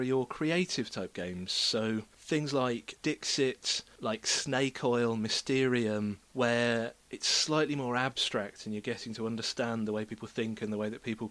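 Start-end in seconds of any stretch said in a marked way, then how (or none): none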